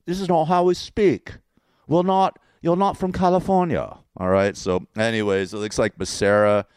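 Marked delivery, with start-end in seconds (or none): 0.1-4.0 higher-pitched fake English accent; exaggerated English put-on voice; mock English accent. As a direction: mimic the Englishman, hammed-up. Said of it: "we don't talk like this".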